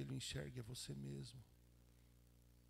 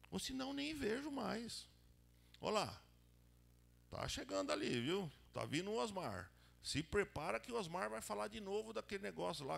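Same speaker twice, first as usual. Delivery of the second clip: higher, louder gossip voice warning a friend; mocking warning; 6 semitones higher, 4 dB louder than usual. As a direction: sound like you're dodging the pest again. Mock warning that Osmar is coming.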